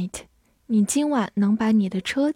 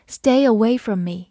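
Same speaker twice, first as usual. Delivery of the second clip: ordinary talk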